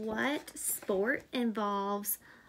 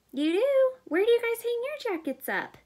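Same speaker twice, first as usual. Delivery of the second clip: much higher and louder, thrilled; 12 semitones higher, 7 dB louder than usual. Real, not acted. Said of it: "asks kids excitedly".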